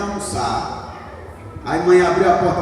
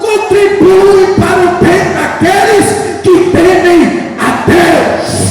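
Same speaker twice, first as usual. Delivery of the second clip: loud, high, chanted preaching; preaching at full force; 6 semitones higher, 9 dB louder than usual. Acted. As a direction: preach it out, building to a shout. Preaching climax. (pregação em alta voz, quase cantada)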